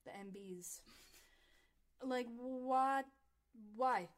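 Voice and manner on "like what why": shocked disbelief. Real, not acted. Shocked at the girl's situation.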